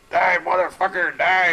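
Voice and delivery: high, slow mock scream; retold scream. Inmate yelling at the man he's stabbing, high slow yell. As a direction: yell it like a man losing a knife fight.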